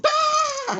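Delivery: loud high-pitched "Ah!"; jump-scare yell, loud and high; sudden scare shout. Retold or imitated. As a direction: startle someone with a sudden shriek. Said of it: "shake it in front of Brandon's face and go".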